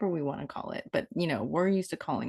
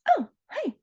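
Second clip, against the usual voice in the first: high mock greeting. Mock channeled voice.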